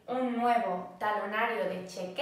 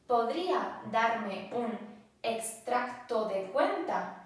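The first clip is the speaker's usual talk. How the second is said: ordinary talk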